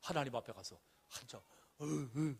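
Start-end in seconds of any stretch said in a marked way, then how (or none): 1.8-2.4 short mock sobs, drawn out; wordless boo-hoo, put on; imitated sobbing. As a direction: imitate a child crying before God. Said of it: "하면서 막 울었겠죠".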